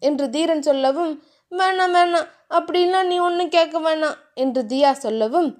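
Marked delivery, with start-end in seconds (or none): 1.5-4.2 put-on higher, bashful girlish voice; flustered young wife; shy heroine. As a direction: brush him off shyly, voice lifted.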